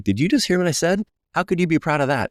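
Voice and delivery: can't believe what he just heard; incredulous disbelief. Retold or imitated. Stunned at coach's praise.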